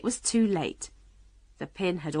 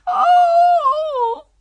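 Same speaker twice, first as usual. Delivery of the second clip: long, high, very loud "oh"; scream; 12 dB louder than usual. A narrator's reading. Queen dreading the coming pinprick, screaming. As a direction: let out a drawn-out shriek. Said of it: "the Queen's screams".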